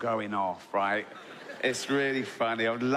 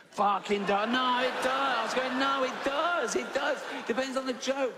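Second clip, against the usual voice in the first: high, strained exasperated insisting; exasperated retort; 10 semitones higher than usual. Retold or imitated. Arguing with the woman online, high exasperated insisting.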